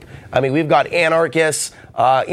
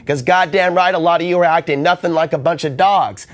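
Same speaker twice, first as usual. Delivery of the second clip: ordinary delivery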